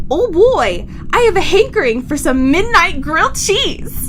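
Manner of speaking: imitating a Midwestern stranger's cheery craving, lightly; slight Midwestern. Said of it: "in a slightly Midwestern tone said".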